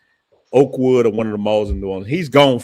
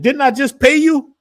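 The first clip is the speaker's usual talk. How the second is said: incredulous dad; 13 semitones higher, 5 dB louder than usual. Retold or imitated. Father mocks son at counter.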